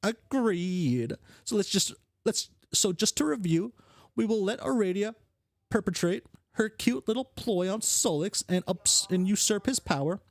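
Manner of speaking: high, cocky plotter's voice; scheming brash character. Acted. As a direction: lay out the plot like a smug schemer.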